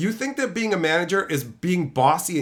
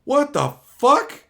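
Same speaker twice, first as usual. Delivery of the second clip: coworker shocked; 7 semitones higher, 4 dB louder, much slower than usual. Retold or imitated.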